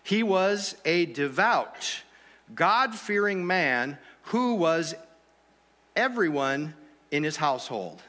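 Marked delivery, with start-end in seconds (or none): none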